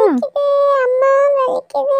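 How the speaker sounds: very high tearful cry of pain. Acted. Rahul cries to mum as the needle goes in, high painful wail. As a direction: wail it like a hurt child. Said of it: "ராகுல் இப்படி அழுவுறான்".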